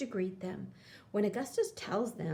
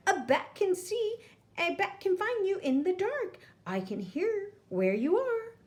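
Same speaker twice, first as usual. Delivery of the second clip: screeching bat; 10 semitones higher than usual. A narrator's reading. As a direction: taunt them from the dark like a bat. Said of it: "Bad Egg screeches".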